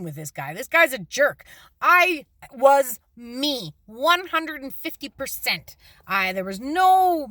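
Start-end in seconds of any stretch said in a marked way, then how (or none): 1.8-5.8 slow, emphatic and louder; louder, drawn-out emphasis on being herself. Real, not acted. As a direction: stretch it out to drive the point home. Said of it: "I'm not playing no games".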